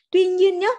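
"Tuy nhiên" pitched high and loud; emphatic caution. Real, not acted. Teacher warns class of an exception to the rule, emphatic.

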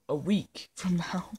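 breathy giggle through the words; whispered laugh. Real, not acted. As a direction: laugh it out under your breath. Joking about slow uploads.

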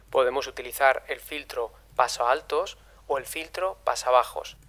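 usual speaking voice

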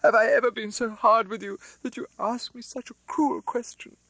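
a hurt sister pleading with her brother; a touch of sobbing reproach; tearful plea. A narrator's reading. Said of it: "bursting into tears".

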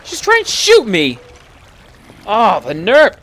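shrill high outraged yell at the skeleton. Real, not acted. Gamer yelling at a skeleton trying to shoot her, outraged.

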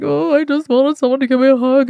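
whiny plea. Retold or imitated. Retelling her childish wish for comfort.

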